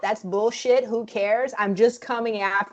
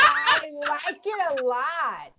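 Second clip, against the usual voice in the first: delighted approval; 5 semitones higher, slower than usual. Real, not acted. Reacting to the villain pitch.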